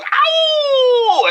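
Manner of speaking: very high howled ow like a dog. Acted. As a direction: howl it out like a hound baying at the moon. DJ howls after his name.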